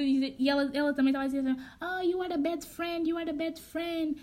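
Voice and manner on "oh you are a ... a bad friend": slow, higher-pitched mock complaint; drawn-out retold whine; lightly voiced complaining girl. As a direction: imitate her dragging out the reproach.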